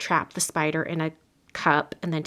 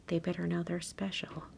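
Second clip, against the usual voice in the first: soft whisper to the camera; hushed, confiding whisper; whispered aside; 8 dB quieter than usual. Real, not acted. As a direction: let it drop into a confiding whisper. Quiet aside about the card.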